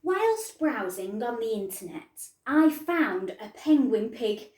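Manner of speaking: lively storybook reading voice; reading to children. A narrator's reading. Teacher reading a picture book to her pupils, lively. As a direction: read the rhyme out to the class.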